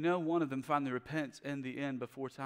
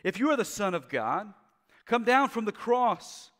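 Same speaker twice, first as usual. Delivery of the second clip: mocking taunt; 9 semitones higher, 10 dB louder than usual. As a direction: sneer it up at the cross. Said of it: "They continue to mock him".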